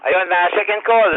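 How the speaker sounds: normal talking voice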